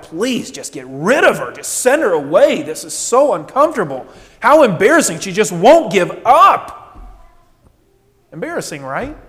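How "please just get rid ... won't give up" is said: louder, exasperated plea to send her away; annoyed disciples. Retold or imitated. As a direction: push it out, fed up and embarrassed. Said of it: "they're like".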